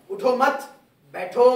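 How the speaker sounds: loud उठो मत, break, then loud बैठो; pause after मत. Shown as a demonstration. Teacher shows the reversed meaning, pausing after मत. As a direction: stop on मत, then punch बैठो on its own. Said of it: "यहाँ रुकता हूँ".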